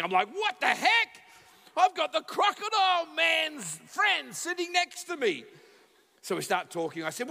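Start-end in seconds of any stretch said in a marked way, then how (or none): 0.3-5.4 high, loud starstruck gushing; fangirling. Retold or imitated. Passenger thrilled by his famous seatmate, fangirling. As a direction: gush in hammed-up disbelief. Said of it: "I'm, I'm fangirling".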